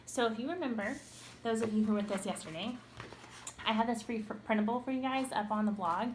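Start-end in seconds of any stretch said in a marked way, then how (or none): none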